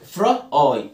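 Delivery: "فرا آي" in two parts, fra then eye; long i, sounded out. Shown as a demonstration. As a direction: split "فرا آي" before a firm long i. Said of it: "بتعمل لونج آي".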